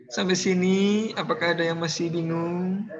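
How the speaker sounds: put-on teacher clowns in a celebrity accent to wake up his class; mock celebrity accent. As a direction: play a TV star's Indonesian accent for laughs. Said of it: "Ini logat Laut Cinta Luna kayaknya".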